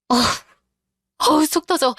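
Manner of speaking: high, frustrated outburst; exasperated. Acted. Wife fed up with husband's gaming excuses, exasperated.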